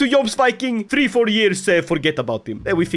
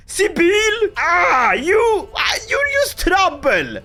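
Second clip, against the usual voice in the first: higher exasperated cry at Sibyl; mock scolding; 8 semitones higher, slower than usual. Father scolding daughter Sibyl, higher and exasperated. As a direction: tell off the wayward daughter.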